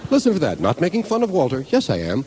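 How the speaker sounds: usual speaking voice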